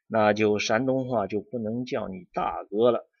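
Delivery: ordinary talk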